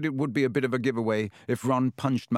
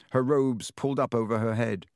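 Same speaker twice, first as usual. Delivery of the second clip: ordinary delivery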